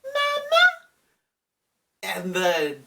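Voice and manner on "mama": put-on very high tiny child calling out; toddler voice. Little child's voice calling out to her mama, very high. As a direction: squeak it like a two-year-old. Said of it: "a two-year-old's voice just saying".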